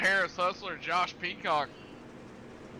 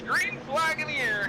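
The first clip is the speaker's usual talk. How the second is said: high ringing race-start call; excited flag call; 11 semitones higher than usual. Real, not acted.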